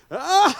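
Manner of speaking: loud high burst of a laugh; sudden high "ah" of laughter; imitated laughter. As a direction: burst out with one big mock laugh. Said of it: "십 분간 웃었답니다".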